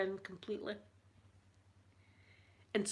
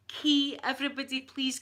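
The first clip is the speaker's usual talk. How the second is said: excited call; 5 semitones higher, 5 dB louder than usual. Real, not acted. Calls out the new member.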